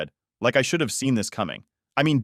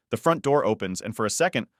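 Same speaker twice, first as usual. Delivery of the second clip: ordinary delivery, nothing marked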